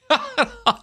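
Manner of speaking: squeaky high laugh; high cackle. Real, not acted. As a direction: cackle in triumph.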